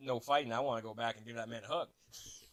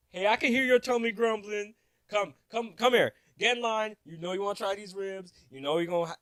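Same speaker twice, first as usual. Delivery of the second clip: loud, high vendor's pitch; mock yelling, raised high and urgent; imitated yelling; 9 semitones higher, 9 dB louder than usual. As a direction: holler like a vendor pulling someone into line. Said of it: "this guy's yelling at me".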